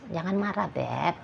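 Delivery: calm her down tenderly; softly soothing an upset partner. Retold or imitated. You calm the partner gently.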